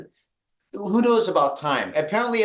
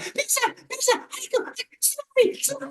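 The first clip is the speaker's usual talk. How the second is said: tiny, very high-pitched shrieked warning; imitated terrified screaming; 4 dB quieter than usual. Woman on TV warning of tsunami, mock screaming in terror. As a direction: squeal it like a panicked announcer, without volume. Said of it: "she was screaming".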